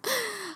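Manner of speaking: short high, quiet laugh; soft wry laugh. Real, not acted. Laughs after rant. (自嘲地轻笑)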